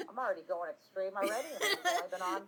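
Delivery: laughing disbelief. Real, not acted. Streamer amazed at how soon stream went off track, laughing disbelief. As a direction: laugh through your own disbelief. Laughs at her own slip.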